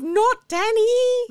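high, loud, drawn-out mock wail; dismayed cry. Co-host reliving the moment Danny shows up, dismayed cry. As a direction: cry it out in mock horror, stretching it. Mimics the shocked film moment.

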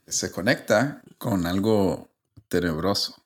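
hint at something creepy, playfully; mock spooky tease. Host previews his eerie story to friends, mock ominous.

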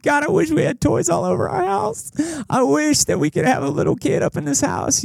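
high, soft mock-tearful wail; mock crying. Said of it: "just crying like".

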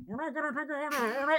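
very soft, high, blurred murmur; faint, far-off imitation of the nurse; muffled distant voice. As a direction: sound like someone heard through ringing ears. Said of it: "And you hear her".

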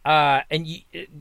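ordinary delivery

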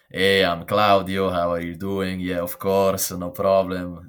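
slow, loud, overly eager beginner's English; mock his own clumsy early English; imitating his old English. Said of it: "my English was very".

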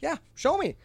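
high mock demand; mock demanding customer. Customer demanding proof, high mock demand. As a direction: pitch it up, pushy.

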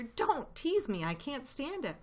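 high-pitched, pained protest; pleading cry. A narrator's reading. Hungry badger begs Fox not to tease, crying out. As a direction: beg him to stop, pitched high. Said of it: "cried Badger".

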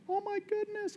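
high, hushed gasp of wonder; imitating being dazzled; mock awe. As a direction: swoon over the fancy hospital. Mock awe at flash hospital.

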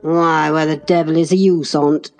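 growling. A narrator's reading. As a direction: growl it out, rough lad. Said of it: "growled Hareton".

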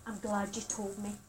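quiet textbook reassurance; trained reassuring line. Shown as a demonstration. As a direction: say it gently like a trained counsellor. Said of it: "you're always taught ... you say".

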